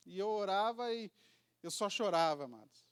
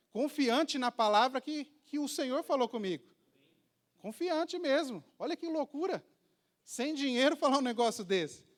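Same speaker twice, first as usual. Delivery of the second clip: excited preaching; 6 semitones higher, 5 dB louder than usual. Real, not acted. Preacher stresses his bold faith.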